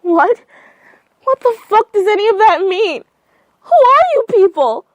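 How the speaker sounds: high, loud screaming questions; panicked screaming demand; horrified screaming. Acted. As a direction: scream it in horror. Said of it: "letting out horrified screams now".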